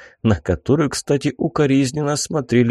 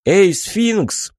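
high call across the room; 9 semitones higher than usual. Acted. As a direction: call out to get attention. Calling out to the sphinx.

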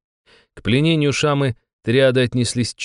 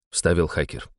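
ordinary delivery

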